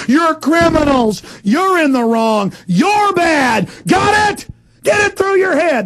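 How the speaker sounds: high, raging yell; angry shout. Real, not acted. Host yelling straight at the spies. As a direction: hammer each accusation home.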